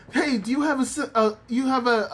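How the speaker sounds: high, eager dog voice; pleading dog. Retold or imitated. Muchan asking around for medicine, high and eager. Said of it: "and him being like".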